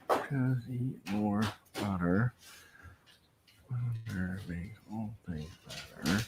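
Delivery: slow, soft, hushed poem reading; reading a haiku. Pretend. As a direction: savor each word like a tiny poem.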